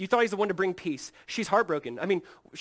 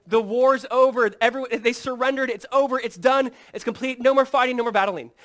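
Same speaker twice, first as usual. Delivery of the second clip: announcing the war is over; 8 semitones higher, 12 dB louder than usual. Pretend. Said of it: "and they say".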